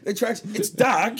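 ordinary delivery

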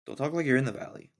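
ordinary delivery

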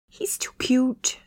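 breathy high whimper; whimpering whisper. A narrator's reading. Candace coos over the dog in a whimpering whisper. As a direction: coo it like a whimper. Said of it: "She whimpered as a baby voice".

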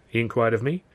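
usual speaking voice

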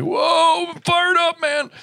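very high, hyped-up mock excitement; fired up. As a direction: squeal it like you're buzzing with energy. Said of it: "You're gonna be like".

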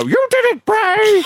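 very high, squealing puppet cheer; funny puppet voice. Retold or imitated. Wrestling fan mimics the puppets' cheer in a high funny voice. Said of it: "such a funny voice".

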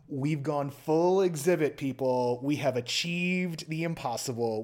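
loud, grandiose proclamation; big, over-the-top hype rant; hammed-up triumph. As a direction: proclaim it like an unstoppable visionary, hitting key words hard. Mock-triumphant proclamation of immersiveception.

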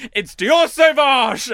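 high, loud, slow proclaiming of the name; wife triumphantly blurting the perfume name; jealous outburst. Retold or imitated. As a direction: claim him loudly and storm off. Jealous wife snapping at shop woman.

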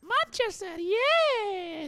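put-on very high, eerie child voice; a spooky little kid answering; creepy kid voice. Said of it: "That sounds creepy when you do that".

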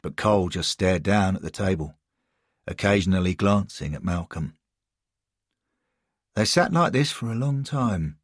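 plain reading voice

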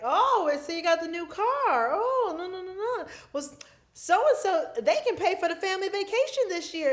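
hammed-up relative making pointed remarks; envious relative. Relative commenting on a family member's new car, needlingly.

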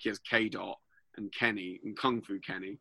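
usual speaking voice

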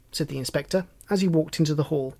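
ordinary delivery, nothing marked

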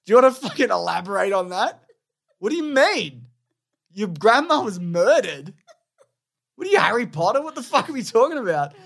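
acted-out exasperated astonishment; incredulous questioning. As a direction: quiz the kid in comic bafflement. Acts out what he can't ask kid.

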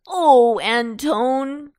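loud, high exclamation; crying out. A narrator's reading. Potato interrupts Antone to correct him, crying out. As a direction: burst in loudly with a protest. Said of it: "cried Potato".

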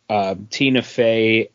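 ordinary talk, nothing marked

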